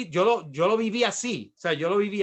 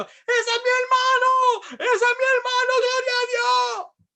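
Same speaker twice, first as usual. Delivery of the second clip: very high, drawn-out screamed cry; sister screaming; 7 dB louder, slower than usual. Retold or imitated. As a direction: imitate an ecstatic sister yelling from the stands. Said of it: "ella estaba gritando".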